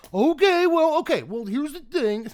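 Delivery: the brain suddenly perking up at a card; intrigued inner voice. Retold or imitated. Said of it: "my brain started going like".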